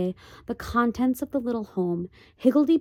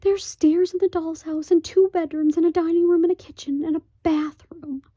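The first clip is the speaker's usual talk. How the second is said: high, loud pleading mother; pleading Homily; 9 semitones higher, 7 dB louder than usual. Acted. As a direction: beg eagerly for the doll's house. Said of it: "pleaded Homily in a husky whisper".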